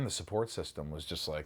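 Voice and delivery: normal talking voice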